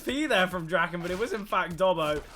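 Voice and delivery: loud, high play-by-play burst; excited commentary. Real, not acted. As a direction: call the shock kill at full volume. Caster reacts live to surprising kill.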